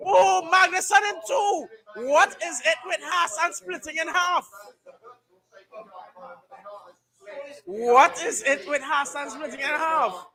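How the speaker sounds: high, laughing disbelief; excited disbelief. Real, not acted. Live crash reaction.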